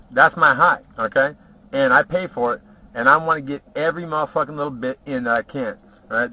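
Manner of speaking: ordinary talk, nothing marked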